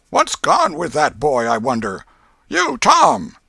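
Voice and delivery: loud, high scolding call; exasperated calling. A narrator's reading. Aunt wondering where the boy is, calling out loudly. Aunt calls, losing patience.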